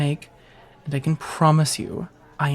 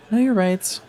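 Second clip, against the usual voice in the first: laughing; 5 semitones higher, 4 dB louder than usual. Acted. Encouraging with a laugh.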